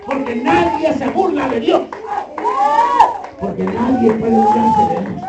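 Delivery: high, ringing preacher's cry at the peak; preaching climax. Real, not acted. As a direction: lift the voice and proclaim it to the congregation. Preacher climaxes on God's judgement. (clamor de predicador en el clímax del sermón)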